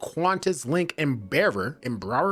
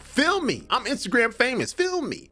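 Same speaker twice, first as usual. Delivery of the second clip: high, mocking imitation of an entitled woman; mocking the Instagram woman; 9 semitones higher than usual. Host mocks the kicked-off woman to viewers, high mocking voice. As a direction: squeak it out like the woman in the clip, poking fun.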